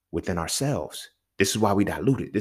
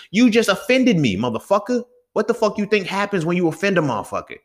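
heated retort; 8 semitones higher, 3 dB louder than usual. Pretend. Mock confrontation with offender.

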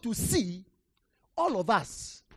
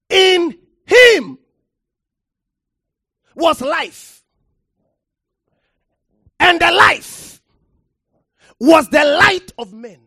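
loud, rising pulpit delivery, hammering the word; preacher thundering from the pulpit; full-force preaching; 7 semitones higher, 15 dB louder than usual. Acted. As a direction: preach it hard to the congregation.

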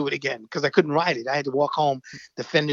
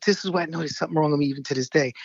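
usual speaking voice

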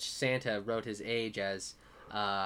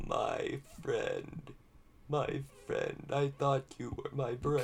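hurt computer voice; 5 dB quieter, slower than usual. Acted. Computer betrayed by being shut down.

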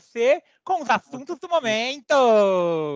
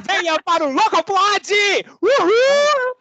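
hype shout; 7 semitones higher, 6 dB louder than usual. Acted. Hosts cheering the podcast intro.

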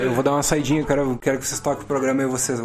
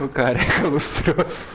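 laughing; 6 semitones higher, slower than usual. Real, not acted. Punchline through laughter.

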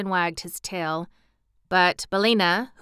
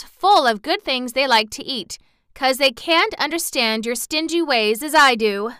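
higher, louder firm defence; determined; 5 semitones higher, 5 dB louder than usual. Acted. Dorothy defends Toto and Billina to the buns, determined. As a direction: argue back with spirit. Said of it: "determined to defend her pets".

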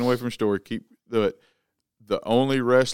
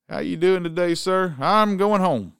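acted trooper dialogue; 6 semitones higher, 5 dB louder, slower than usual.

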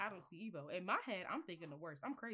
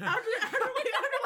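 high-pitched laughing words; laughing hard; 13 semitones higher, 14 dB louder, slower than usual. Real, not acted. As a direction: squeal through laughter. Laughing while telling story.

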